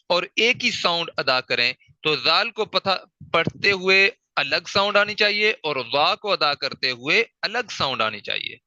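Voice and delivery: ordinary talk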